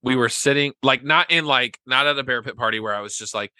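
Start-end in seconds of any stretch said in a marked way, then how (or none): none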